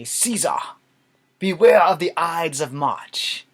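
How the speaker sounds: loud, slow theatrical proclamation; hammed-up beggar's warning; old beggar. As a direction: cry it out like a stage soothsayer. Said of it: "the old beggar who would say".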